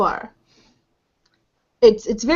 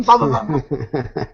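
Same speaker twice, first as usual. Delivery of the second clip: lower, slow, laughing bahs; rattling inside his head; 7 semitones lower than usual. Acted. Rattling sound in his head, slower lower bahs. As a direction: let the bahs rattle slowly, a bit low. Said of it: "You hear it going".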